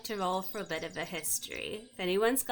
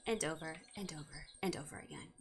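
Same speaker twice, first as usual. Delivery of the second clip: quick, low murmur dying away; fading echo; 5 semitones lower, 12 dB quieter, faster than usual. A narrator's reading. Game master musing on a mimic's endless repeats, fading to a whisper. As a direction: trail off into a hushed repeating echo.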